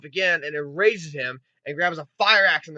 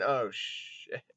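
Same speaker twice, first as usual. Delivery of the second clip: whispered curse; 7 semitones lower, 11 dB quieter than usual. Real, not acted. Aside after laughing.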